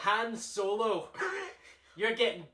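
loud, high Glaswegian shout; thick Glaswegian accent. Acted. Co-host mimics the Scottish guy threatening Han Solo, thick Glaswegian accent. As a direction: bellow it in mock-thick Glaswegian. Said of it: "this guy with this really thick Glaswegian accent".

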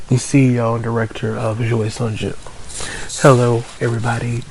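ordinary talk, nothing marked

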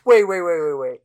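loud string of "wait"s, falling away; mock interruption. Co-host acting out planned interruption of the brag, mock halting. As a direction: cut in with a run of waits. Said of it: "to be like".

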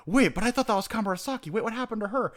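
acting out sudden confusion; confused surprise. Reenacting confusion on reread.

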